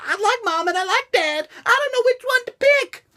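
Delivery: put-on whiny child voice. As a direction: play a kid caught between mom and dad. Child torn between fighting parents.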